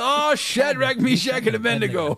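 high, hammed-up cry of the three names; borrowed Veggie Tales exclamation, hammed up; mock exclamation. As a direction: belt it out like a cartoon. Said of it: "Yes, I'm gonna steal".

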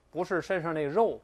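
ordinary talk

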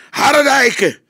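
detective losing patience, furious; snorting with anger. A narrator's reading. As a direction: explode at him. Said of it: "brieste hij".

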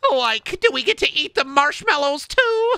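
very high, cracking stoner-cartoon squeal with giggle; Shaggy. Hungry Shaggy asks about marshmallows, goofy squeal. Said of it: "Shaggy, you fucking idiot".

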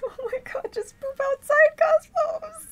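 very high, very loud shriek; exasperated scream. Real, not acted. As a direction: scream at the pet in frustration. Exasperated with the cat.

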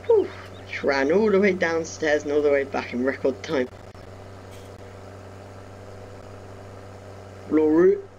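talking out of breath; breathless after running stairs. Real, not acted. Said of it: "Don't know why I'm out of breath".

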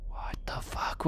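hushed startled whisper. Real, not acted. Gamer whispering at a noise in the dark. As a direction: breathe it out in shock.

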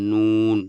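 নুন said loud, tongue tip against the palate; Arabic letter nun. Shown as a demonstration. Teacher modelling নুন for students, loud and clear. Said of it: "ভালো করে উচ্চারণ করুন".

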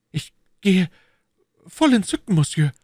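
high, loud delighted exit; delighted Bonacieux. Acted. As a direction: leave in raptures. (entzückt)

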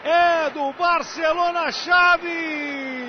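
shouted, stretched goal call; explosive goal commentary. Acted. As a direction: belt out the goal. (narração de gol explosiva)

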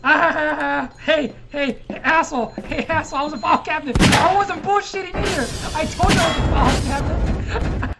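high gloating shouts; triumphant. Real, not acted. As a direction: gloat at the losers. Triumphant gloating after winning.

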